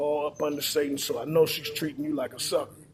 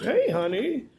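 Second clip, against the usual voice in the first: higher, rising, sugary "Hey, honey."; mock sweet greeting; 7 semitones higher, slower than usual. Husband greeting his wife back at morning, mock sweet. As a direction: play the husband who suspects nothing.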